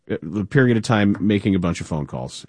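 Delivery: usual speaking voice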